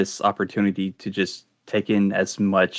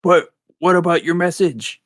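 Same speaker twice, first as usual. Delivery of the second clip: mild raised call, higher pitch; a little too loud; 7 semitones higher, 3 dB louder than usual. Acted. Jimothy calling after the departing robot, a mild high-pitched shout. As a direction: call it out, higher but not a full shout. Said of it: "shouts that a little too loud".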